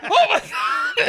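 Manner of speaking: real laughing shout. Real, not acted. Host losing it over Frank's panic, laughing. Cracking up at the scene.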